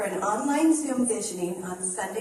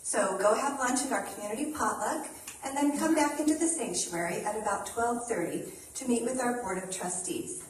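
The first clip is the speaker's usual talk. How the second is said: usual speaking voice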